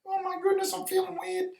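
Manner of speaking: very high squeaky lizard voice; high-pitched cartoon lizard owning up, woozy. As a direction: ramble in a tiny lizard squeak. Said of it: "And the lizard goes".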